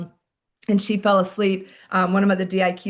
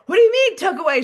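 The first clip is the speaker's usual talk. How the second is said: much higher and louder, stunned disbelief; incredulous outburst; 9 semitones higher, 6 dB louder, faster than usual. Retold or imitated. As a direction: jump up and blurt it, baffled. Officer leaps up in disbelief.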